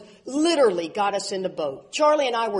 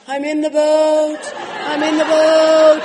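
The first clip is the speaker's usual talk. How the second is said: mock surrender; 5 semitones higher, 9 dB louder, slower than usual. Ron giving in, quoted.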